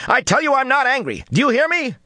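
high, loud furious shout. A narrator's reading. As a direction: shout her down in anger. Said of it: "she shouted".

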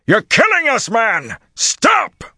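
high, full-voiced yelling plea; desperate shout. A narrator's reading. Bashir begging Rand to stop killing them, shouting. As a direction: yell to make him stop right now.

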